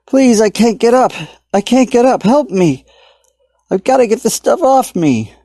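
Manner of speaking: high, loud panicked pleading; desperate yelling. A narrator's reading. Stuck man begging strangers on platform, panicked yell. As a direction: shout for help, trapped and frantic. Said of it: "Tommy yelled to them".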